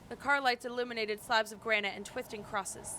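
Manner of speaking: ordinary delivery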